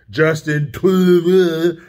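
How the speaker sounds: put-on TRUDEAU slurred and dragged out into a babyish burble; mocking garbled name. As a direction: garble TRUDEAU slowly in mockery.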